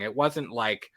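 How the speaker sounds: ordinary talk, nothing marked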